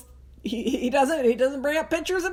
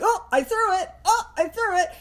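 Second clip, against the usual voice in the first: higher, teasing fake excitement; mock fake-out; 5 semitones higher than usual. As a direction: pretend to throw it for the dog. Acting out a fake throw to a dog.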